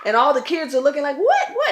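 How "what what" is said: high, loud, startled questions; quick high-pitched yelps of confusion; alarmed questioning. Retold or imitated. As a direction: imitate alarmed kids. Kids' alarmed reaction acted out.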